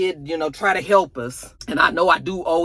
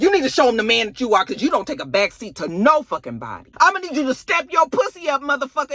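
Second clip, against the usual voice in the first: higher, louder pushy woman; bossy wife; 7 semitones higher, 4 dB louder, faster than usual. Retold or imitated. Wife pushing her husband to step up, higher and louder. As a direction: push him hard in a raised bossy voice. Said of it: "She was like".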